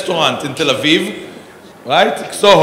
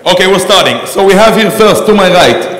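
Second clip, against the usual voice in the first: raised voice to get the room's attention; calling out to start; 3 semitones higher, 9 dB louder than usual. Real, not acted. Host calling the panel to start, raised voice. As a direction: call the hall to order, louder. Host calls the hall to order.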